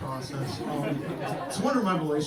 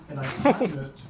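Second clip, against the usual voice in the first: ordinary delivery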